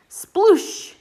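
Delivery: high, loud sploosh; big splash sound. Acted. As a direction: make a big muddy splash. Mud splash sound in story.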